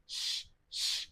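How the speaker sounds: bare "sh", demonstrated twice; sh sound. Shown as a demonstration. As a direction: hiss out "sh" as a lone consonant. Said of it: "a pronunciation like".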